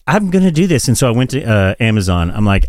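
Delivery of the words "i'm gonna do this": higher, eager resolve; excited. Retold or imitated. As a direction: say it like you're hooked. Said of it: "I was like".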